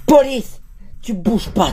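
loud, high commanding shout; police shout. Acted. Cop storming the room, loud commanding shout. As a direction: burst in and bark it.

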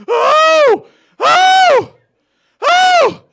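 piercing high wailing sobs, very loud; crying wail. Retold or imitated. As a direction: wail like a sobbing kid. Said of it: "he was just going".

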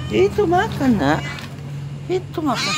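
very high squeaky pet talk; high coaxing voice to a hungry kitten. Real, not acted. Urging kittens to eat.

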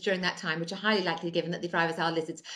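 rattled off fast like small print; legal small print read. Acted. Godmother reading terms to Cinders, fast small-print gabble. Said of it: "please agree to these terms and conditions".

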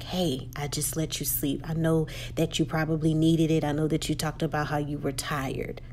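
soft, low, gentle voice. Retold or imitated. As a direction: ease her awake softly. Husband lets her sleep, gentle.

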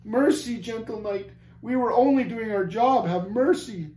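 high, pleading whimper. A narrator's reading. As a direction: whimper for mercy like beaten men. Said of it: "kept up a constant whimpering".

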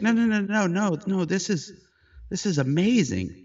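high, loud rush of no's; imitating her eager, tearful protest; excited reassurance. Woman assuring the photographer she is not upset, high excited no's.

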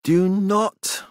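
high, sharp snap; snapped. A narrator's reading. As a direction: cut him off hard. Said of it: "Elizabeth snapped".

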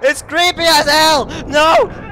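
high, loud panicked yelling; terrified screaming. Real, not acted. Player screaming that it's still following, terrified. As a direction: shriek it as the thing gains on you.

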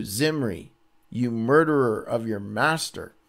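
ordinary talk, nothing marked

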